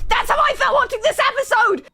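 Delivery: high, loud outburst; exasperated shout. Real, not acted. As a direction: yell it in disbelief.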